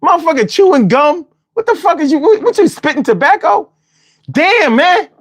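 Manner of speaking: indignant outburst. Real, not acted. Mocking caller's mouth.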